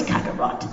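ordinary delivery

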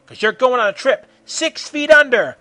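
loud, high threatening yell; scream. A narrator's reading. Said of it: "screamed Goku".

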